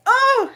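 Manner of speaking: short loud high yell, acted out; mock cry of venting anger; imitated angry outburst. Men banging onto something to release anger, imitated angry yell. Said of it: "might want to, like, bang onto something".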